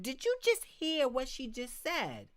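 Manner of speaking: put-on a mother staring her child down; mother scolding her child in front of a neighbour; stern mother. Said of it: "Be like".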